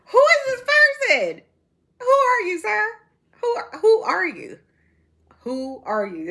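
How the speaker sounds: high, slow, laughing disbelief; drawn-out incredulous questioning, starting high and loud; mock bewilderment. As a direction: act baffled by a stranger, laughing through it. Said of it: "'cause I'm just like".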